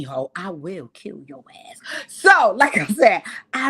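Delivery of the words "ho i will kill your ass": low whispered menace; whispered threat. Real, not acted. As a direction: breathe the threat.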